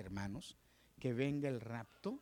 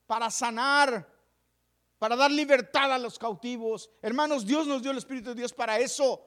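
high, loud, fiery preaching; full-force preaching; 8 semitones higher, 11 dB louder than usual. Acted. Pastor urging the church to give the Spirit, loud and fiery. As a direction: thunder it to the congregation.

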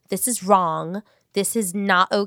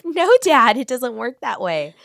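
louder and higher, a teasing exasperated no to dad; exasperated correction; 6 semitones higher, 7 dB louder, slower than usual. Retold or imitated. Daughter corrects dad's Spanish, loud exasperated protest. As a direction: push back on dad, laughing at him.

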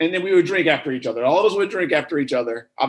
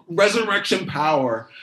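ordinary talk

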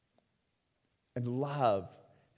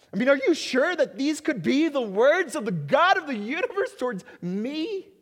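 incredulous; 11 semitones higher, 7 dB louder than usual. Acted. Incredulous rhetorical question to listeners.

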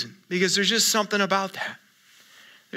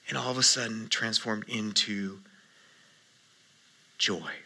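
hushed tender; 10 semitones lower, 11 dB quieter than usual. Real, not acted. Pastor hushes on transformation to joy.

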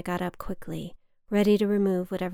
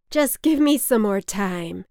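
louder, slurred plea; slurring; 8 dB louder than usual. Acted. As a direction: beg through the drink.

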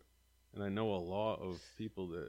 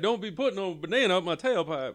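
movie quote voice; 9 semitones higher, 9 dB louder than usual. Retold or imitated.

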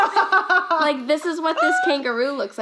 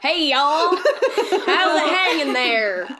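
loud, slow joking American drawl; stretched-out mock American greeting; hammed-up American accent; 3 semitones higher, 5 dB louder, much slower than usual. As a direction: play up a folksy American accent, laughing. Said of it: "we say that a lot here in the P N W".

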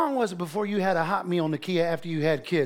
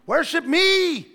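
high, slow, louder mock decree; baby as little god demanding worship, high slow; 9 semitones higher, 6 dB louder, slower than usual. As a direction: command like a tiny tyrant. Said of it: "they want you to worship".

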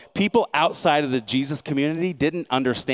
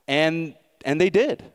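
normal talking voice

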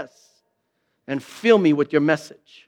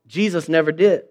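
ordinary talk

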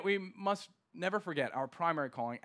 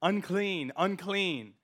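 a slightly raised call of unclean, unclean; crying out; 3 semitones higher, 4 dB louder, much slower than usual. A narrator's reading. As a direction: call out the warning, a little louder. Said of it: "cry out".

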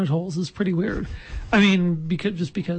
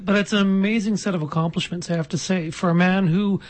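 ordinary talk

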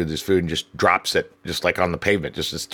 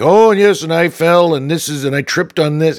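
higher, louder, eager complaining guest; guest's spiel; 8 semitones higher, 6 dB louder than usual. Retold or imitated. Old guest pitching his fake falls to the manager, eager. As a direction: gush the rehearsed sob story. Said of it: "go into the spiel".

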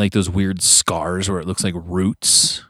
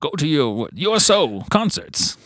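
put-on much higher old newsreel announcer; World War Two announcer; 8 semitones higher, slower than usual. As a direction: sell war bonds like a 1940s radio voice. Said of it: "World War Two one".

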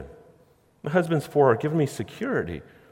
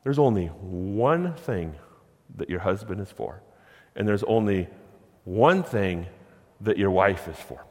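normal talking voice

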